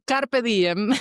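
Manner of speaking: carpe diem in a hammed-up Italian accent, rising; a proud little Italian flourish. Shown as a demonstration. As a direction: lay on a playful Italian accent. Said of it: "that was very Italian accent".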